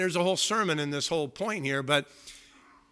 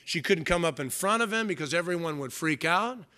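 ordinary talk

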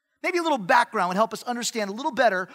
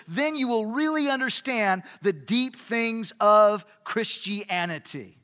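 loud, insistent pitch easing at the end; Judaizers' demand; 3 semitones higher, 5 dB louder than usual. Acted. Judaizers tell Gentiles to come under the law, loud and insistent. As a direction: press the listeners hard, stretching key words. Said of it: "And they were saying".

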